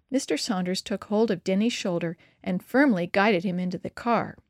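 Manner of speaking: ordinary delivery, nothing marked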